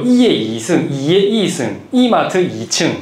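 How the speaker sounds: 이의 이 승 and 이마트 이 층 with a Gyeongsang double ieung; Gyeongsang pronunciation demo. Shown as a demonstration. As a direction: slowly sound out 이마트 이 층 the Daegu way. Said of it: "그거 알죠?".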